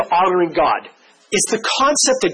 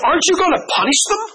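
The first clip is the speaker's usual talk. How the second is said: high, quick indignant question; indignant parent; 10 semitones higher, faster than usual. Retold or imitated. Furious camp parent demanding punishment, high mock indignation. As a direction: ask it high and fast like an upset parent. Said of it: "And I said".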